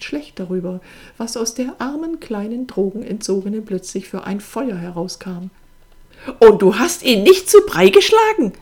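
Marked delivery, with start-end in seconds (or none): 6.4-8.5 high, very loud incredulous accusation; outraged. A narrator's reading. Sister demanding why her brother didn't fight, outraged. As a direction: yell it in disbelieving fury.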